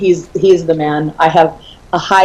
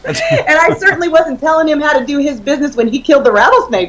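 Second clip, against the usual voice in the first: chuckling through the line, higher; laughing; 7 semitones higher than usual. Real, not acted. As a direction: let the laugh run under the words. Laughing over snake story.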